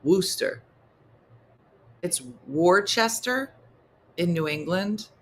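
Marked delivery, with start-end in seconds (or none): 0.0-0.6 "Worcester" as two-syllable "Wooster"; shortened Worcester. Shown as a demonstration. Example of not pronouncing it all, shortened "Wooster". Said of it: "don't pronounce all the words". 2.5-3.5 "Worcester" as "War-chester", every part sounded; spelled-out Worcester. Shown as a demonstration. Visitor to New England reading it as written, "War-chester". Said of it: "You mean Worcester".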